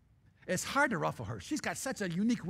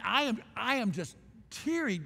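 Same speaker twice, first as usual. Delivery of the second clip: ordinary talk, nothing marked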